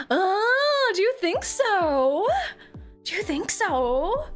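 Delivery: high, slow teasing question; coy teasing. Real, not acted. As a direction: play coy with chat. Coy reply to chat.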